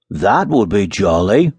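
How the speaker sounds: slow, relishing exclamation; delighted cry. A narrator's reading. Miller hearing of roast meat, slow delighted cry. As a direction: stretch it out with relish. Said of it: "cried the miller".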